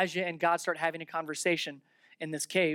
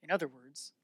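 usual speaking voice

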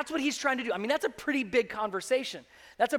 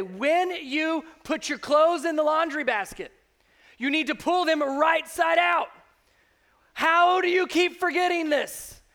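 high, loud, slowed scolding; nagging wife; 6 semitones higher, 6 dB louder than usual. Retold or imitated. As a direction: spell it out to a forgetful husband. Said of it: "Wives, I have no doubt that you have looked at your husbands".

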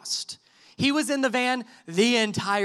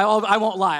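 usual speaking voice

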